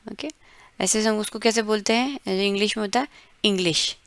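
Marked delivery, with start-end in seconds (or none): none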